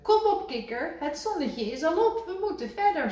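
high, loud cheerful call; Rad waking Kikker. A narrator's reading. Rad shaking Kikker awake, high and loud. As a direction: rouse him briskly. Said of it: "zei Rad".

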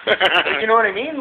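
normal talking voice

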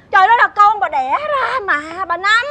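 high, slow, drawn-out reproach; hammed-up outrage; scandalized scolding. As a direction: scold them as if utterly appalled. Scandalized accusation against the parent.

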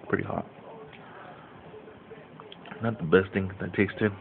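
eating between words; talking while chewing. Real, not acted. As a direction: mouth partly full. Seen 1: Mouth looks busy; holds bitten red snack while talking.

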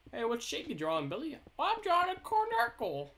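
put-on high child voice asking and answering; playing a kid. Pretend kid Billy tells friend his shape. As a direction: play a kid showing off a drawing.

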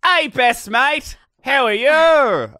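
high, slow, loud mock Australian accent; hammed-up Australian. Cohost greets her friend in an exaggerated Australian accent. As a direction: ham up an Australian greeting with a shout. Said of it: "Instantly Australian".

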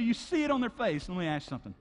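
ordinary delivery

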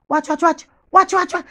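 high, rapid repeated alert; giddy excitement. Acted. Woman alerting Jenny that the neighbour is peeping, high excited alert.

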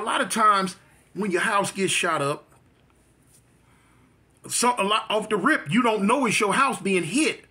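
usual speaking voice

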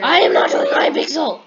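loud, emphatic outburst; loud refusal. Real, not acted. Player refusing the other player's game, shouted protest. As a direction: shout down the suggestion.

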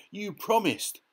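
high, angry yell; furious Dorothy. A narrator's reading. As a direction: shout the broken promise. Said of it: "she yelled".

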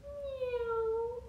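high soft meow; teacher meowing softly for children; kitty meow. Shown as a demonstration. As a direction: mew like a little kitten. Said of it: "how about this one?".

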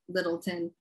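Littleton tossed off low and soft; local pronunciation. Acted. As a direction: say "Littleton" with the last syllable shrunk to "tin". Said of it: "They say it just like that".

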